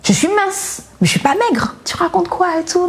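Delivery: retold protest, voice jumping right up; annoyed. Daughter in denial answers her mother, annoyed. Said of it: "Et je m'énervais".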